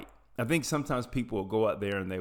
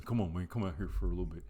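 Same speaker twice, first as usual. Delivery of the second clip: low, quick Southern mumble, lightly Elvis; Elvis-like Southern accent; 4 semitones lower, 5 dB quieter, much faster than usual. Retold or imitated. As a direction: coax a buddy in a half-hearted Elvis accent. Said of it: "I'm sounding like [name]".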